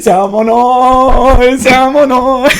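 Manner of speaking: slow, high, drawn-out salmon oil; hammed-up slow chant; drawn-out bit. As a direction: stretch each word like a running gag. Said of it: "One more time".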